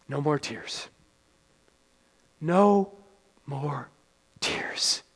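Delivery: low, very soft, with long pauses; quiet, lingering assurance; hushed, solemn. Real, not acted. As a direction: let each word sink almost to a whisper. Solemn repeated promise of heaven.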